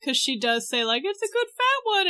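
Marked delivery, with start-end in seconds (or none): 1.0-2.1 high cooing witch. Retold or imitated. Witch praising the child.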